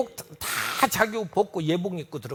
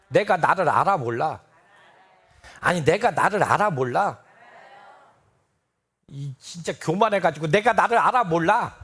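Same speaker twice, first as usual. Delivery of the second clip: mock scoff; 3 semitones lower, 6 dB louder than usual. Preacher mocking congregation's pride.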